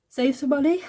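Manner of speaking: words stretched by a yawn. Real, not acted. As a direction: say it mid-yawn. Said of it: "Sorry that I keep yawning".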